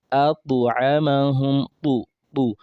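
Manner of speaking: atu amahum, with a light echo vowel after the t; slow atu amahum, the t bounced with qalqala; Arabic ta with echo. Shown as a demonstration. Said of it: "ta comes with an echo sound".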